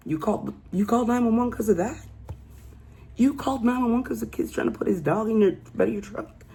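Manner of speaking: high, fast incredulous questioning; can't believe she called for that; disbelief. Real, not acted. Speaker reacting in disbelief to caller.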